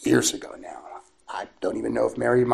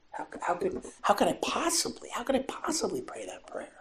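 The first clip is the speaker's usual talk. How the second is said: tearful, higher whispered plea; weeping disbelief; 8 semitones higher, 9 dB quieter than usual. Retold or imitated. Mary doubting she can pray it, tearful whisper. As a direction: ask it through tears. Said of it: "I could hear her weeping".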